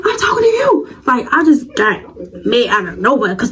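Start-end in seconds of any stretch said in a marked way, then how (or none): 0.0-0.9 high laughing challenge; mock yell. Confronting the rival girl in the hallway, high laughing yell. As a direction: shout it back half laughing.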